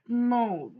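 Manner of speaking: high loud "No!"; loud protest. Real, not acted. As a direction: cry out in dismay. Reacting to the game.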